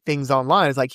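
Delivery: ordinary delivery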